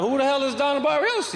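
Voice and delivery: high, laughing, incredulous question; laughing who-is-that surprise; shocked laughing disbelief. Retold or imitated. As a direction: blurt it out with a laugh, baffled. Shocked quote at draft pick.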